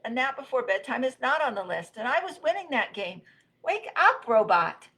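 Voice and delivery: high complaint rising to a loud call; wail, then shout to wake someone; wailed protest. Acted. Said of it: "wailed Rabbit".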